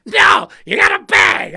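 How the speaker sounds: loud insistent worker; mock-loud retort; mock shout. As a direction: bark it back insistently. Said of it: "He goes".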